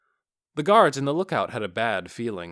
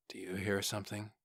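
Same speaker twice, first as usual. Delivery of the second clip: hushed question; 3 semitones lower, 11 dB quieter than usual. A narrator's reading. Guard listening into the dark.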